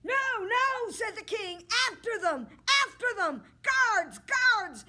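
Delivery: very high, loud cry for the guards; a king calling his guards after the couple. Acted. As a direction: shout after them in panic.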